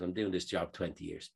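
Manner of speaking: low, soft retold voice; calm confiding. Airline worker reassuring an upset passenger, calm. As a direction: confide quietly, pitch dropped.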